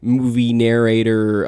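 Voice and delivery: loud, drawn-out demand of a disappointed player; hammed-up outrage, leaning slowly on each word; mock indignation. Mocking players outraged at plain DM.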